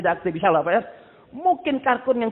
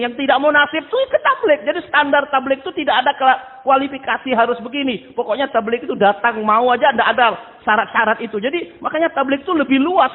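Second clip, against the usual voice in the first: higher and louder, driving the point home to the congregation; forceful preaching; 6 semitones higher, 6 dB louder than usual. Acted. Preacher urging the congregation about tablik, forceful preaching.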